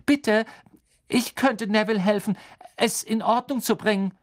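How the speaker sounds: hohe, laute Mädchenstimme; flehende Hermine. A narrator's reading. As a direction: den Lehrer eindringlich anflehen. Hermine bittet für Neville. (flehend, hoch und laut)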